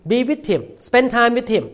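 high, loud exhortation; fiery preaching. Real, not acted. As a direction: urge the women on with fire. Preaching at full force.

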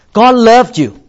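a hint of a Southern revival preacher. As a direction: proclaim it twice like a Southern preacher. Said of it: "the Southern preacher always say like this".

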